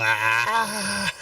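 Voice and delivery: high echoing villain cackle; evil cackle. Acted. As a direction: cackle like a gleeful monster. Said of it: "You hear this cackle".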